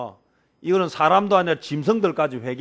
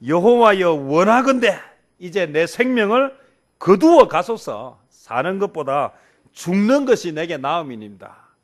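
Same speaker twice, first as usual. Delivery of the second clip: much louder, slow, bitter cry to take his life; Jonah's fed-up outburst, loud and dragged out; exasperated plea; 3 semitones higher, 7 dB louder than usual. Acted. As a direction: plead with God out of sheer spite. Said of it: "얼마나 약이 올랐는지요".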